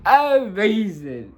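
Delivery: amazing stretched slow and high, every syllable drawn out; drawn-out emphasis. Real, not acted. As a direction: lean into amazing, stretch it slowly and pitch it up. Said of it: "and it was amazing".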